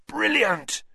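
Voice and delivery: een hese, hoge roep; hoarse call. Acted. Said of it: "he called hoarsely after her".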